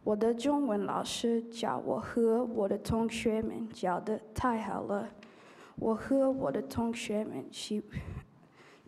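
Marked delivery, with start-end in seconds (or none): none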